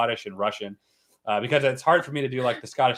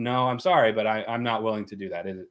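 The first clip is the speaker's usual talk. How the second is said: ordinary talk, nothing marked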